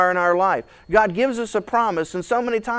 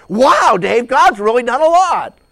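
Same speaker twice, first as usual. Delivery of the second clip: impressed; 5 semitones higher, 9 dB louder than usual. Retold or imitated. Listener impressed by highlights.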